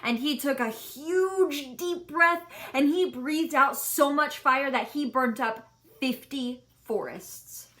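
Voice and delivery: ordinary delivery, nothing marked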